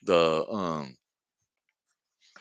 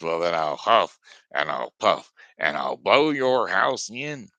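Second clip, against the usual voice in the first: louder menacing wolf growl of a threat; threatening wolf; 4 dB louder than usual. Acted. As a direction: roll out the threat like the big bad wolf. Wolf threatens second house.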